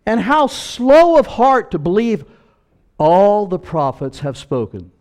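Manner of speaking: loud, high preacher's climax; full-force preaching. Acted. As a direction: build it like a pulpit crescendo, slow on the quotes. Quoting Jesus from pulpit.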